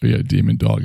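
ordinary talk, nothing marked

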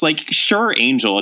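usual speaking voice

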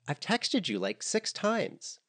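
high, exasperated and drawn out; annoyed. A narrator's reading. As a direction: whine the complaint, slowly. Annoyed redhead complains.

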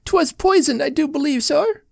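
very high wailing plaint; crying. Acted. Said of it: "Missus Casey began to cry".

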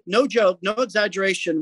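usual speaking voice

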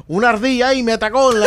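loud, high, fast punchline; retold outcry. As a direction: blurt it out as the attacked thief. Retold victim complaint, punchline. (grito rápido del ladrón atacado)

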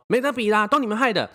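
imitating the customer's accusing whine; mock whiny blame. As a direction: whine it like the blaming mom. Mother blaming staff.

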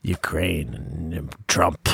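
put-on slow, low Russian accent. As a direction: drag the words out in mock Russian. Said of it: "Russian Brandon".

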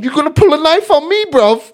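high, loud mock southern hard man; imitated shouted challenge; aggressive accusation. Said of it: "He starts going".

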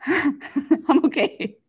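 slow laughing reassurance. Real, not acted. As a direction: laugh it off after a fright. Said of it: "You're okay".

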